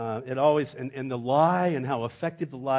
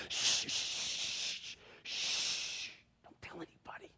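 hushed shushing whisper; 11 dB quieter, much slower than usual. Retold or imitated. Fire crew hushing the new guy, whispered shushing. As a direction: shush them all, whispered. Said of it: "Everybody go".

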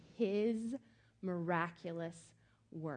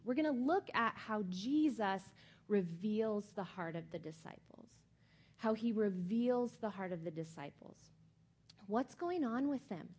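ordinary delivery

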